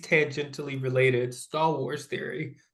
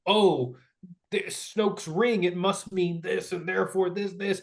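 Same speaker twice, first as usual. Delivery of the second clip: higher, slowed mock pronouncement; mock theorist; 4 semitones higher than usual. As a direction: parody an overexcited theory video. Mimicking a theorist's videos.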